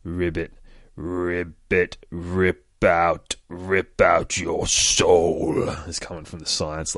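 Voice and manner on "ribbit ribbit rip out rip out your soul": menacing frog croak, drawn out slowly at the end; a frog croak turning demonic. Acted. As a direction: build from ribbits into a loud, slow threat. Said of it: "it's saying ribbit".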